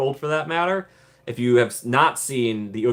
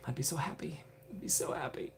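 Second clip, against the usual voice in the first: whisper; 3 semitones higher, 17 dB quieter than usual. Real, not acted. Wishing for Maleficent on ride.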